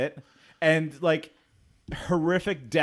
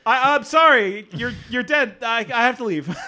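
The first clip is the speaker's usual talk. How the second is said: mock cowardly Jake; 6 semitones higher, 6 dB louder than usual. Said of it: "He's just like".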